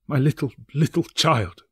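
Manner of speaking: a father's voice breaking; mourning his little son; crying out in grief. A narrator's reading. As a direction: let the grief break through. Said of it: "cried Bob".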